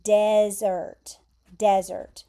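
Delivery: "desert" as DEZ-ert, loud first syllable; pronouncing desert. Shown as a demonstration. Teacher modelling the dry-place noun, "desert" stressed on DEZ. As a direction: model "desert" twice, stressing DEZ, falling away after. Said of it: "And you pronounce it".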